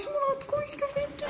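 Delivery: high mock alarm breaking into giggles; fake panic cracking up. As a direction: try to sound scared but laugh.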